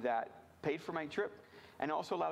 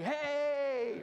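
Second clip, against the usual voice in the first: high, loud burst of pep; hyped youth pastor greeting; 11 semitones higher, 4 dB louder than usual. Retold or imitated. Youth pastor greeting his kids with high, loud energy. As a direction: throw out a pumped-up hey like a youth pastor. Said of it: "They have to be like".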